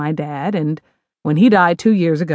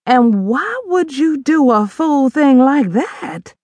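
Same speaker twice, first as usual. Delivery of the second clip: slow, disbelieving mother voice; scolding mother; 8 semitones higher, 4 dB louder, slower than usual. Acted. Mother rebuking daughter over divorce, incredulous. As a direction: chide her foolishness.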